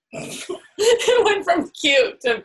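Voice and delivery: high, drawn-out words through laughter; laughing protest. Real, not acted. Teased by partner's dark joke, laughing high protest. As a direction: laugh helplessly while complaining.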